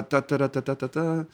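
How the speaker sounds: soft, rattled-off nonsense syllables; placeholder "ta-ta-ta". Real, not acted. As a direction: tick through "ta-ta-ta" quietly while searching for the thread. Filler noises while recovering his thought.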